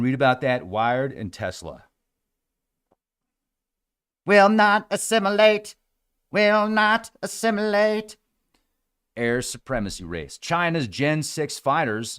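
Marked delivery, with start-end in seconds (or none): 4.3-5.8 high, loud robot chant. Pretend. Robot voice repeated. 6.3-8.2 high, slow robot chant. Pretend. Robot voice repeated.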